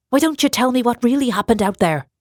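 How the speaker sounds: lightly voiced Irish interrogator; pressing stranger. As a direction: push him for the truth.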